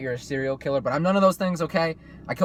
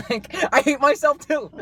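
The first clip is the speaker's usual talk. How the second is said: ordinary talk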